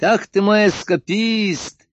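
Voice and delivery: high, slow, gleeful cry; cheerful exclamation. A narrator's reading. Emperor greets the doctor, cheerfully exclaiming. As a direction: exclaim it with delight, drawn out. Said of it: "весело воскликнул император".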